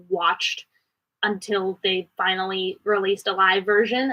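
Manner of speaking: ordinary delivery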